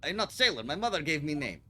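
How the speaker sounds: put-on loud, proud Russian-accented sailor. Senior crewman introducing himself to the visitors, loud Russian accent. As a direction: boom it out in a heavy Russian accent. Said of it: "Russian sailor character voice".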